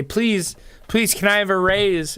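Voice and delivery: high, pleading whine; mock begging employee. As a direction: beg like a timid worker for a raise.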